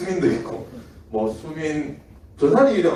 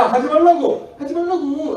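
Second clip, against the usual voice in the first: high, loud laughing yell of stop it; half-laughing high protest; mock protest; 13 semitones higher, 4 dB louder than usual. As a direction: laughingly shout at them to quit. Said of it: "하면서 막 따라하고".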